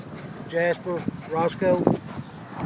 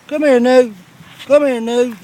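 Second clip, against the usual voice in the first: loud high repeated call; insistent high-pitched dog call; 10 semitones higher, 10 dB louder than usual. Real, not acted. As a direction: keep calling the pup in, louder. Calling a puppy repeatedly.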